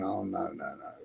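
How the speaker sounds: very soft, low retold thought; mock inward pleading. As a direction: plead under your breath, as if retelling it. Silent dread retold on the bus.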